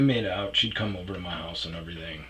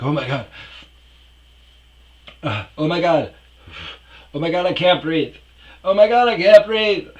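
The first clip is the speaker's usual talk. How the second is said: high, loud panic building to a shriek; mock panic attack; 12 semitones higher, 16 dB louder than usual. Imitating girlfriend's panic attack at the bar, increasingly hysterical. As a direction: gasp and escalate into hammed-up hysterics. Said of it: "panic attacks".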